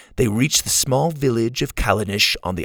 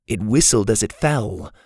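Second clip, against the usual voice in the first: usual speaking voice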